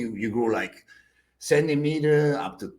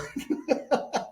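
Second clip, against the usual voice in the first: breathy high laugh; 12 semitones higher, 5 dB quieter than usual. Real, not acted. Laughing at the story.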